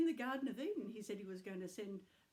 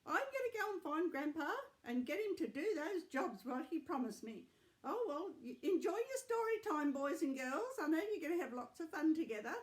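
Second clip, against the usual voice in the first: grandma voice; 6 semitones higher, 4 dB louder than usual. Acted. Grandma puppet voice.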